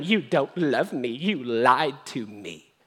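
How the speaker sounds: low, slow mock reproach; manipulative guilt-trip. Delilah manipulating Samson, low slow mock guilt-trip. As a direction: lay on the guilt slowly, mimicking her. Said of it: "She begins to manipulate him".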